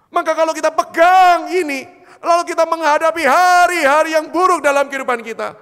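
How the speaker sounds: loud, high, ringing rhetorical questions; full-force preaching. Real, not acted. Preacher proclaiming God's unchanging love to congregation, full-force preaching. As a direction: proclaim it at full power, stretching key words.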